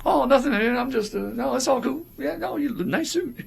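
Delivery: high, stammering mock nervous voice; nervous backpedaling. Brother imitated caving in front of the Nazi, high mock nervous stammer. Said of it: "brother be like".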